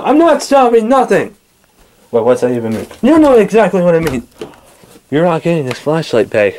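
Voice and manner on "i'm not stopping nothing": high, drawn-out defiance; slow, raised-pitch refusal; defiant refusal. Acted. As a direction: dig in stubbornly, stretching it out. Refusing an order in a quarrel.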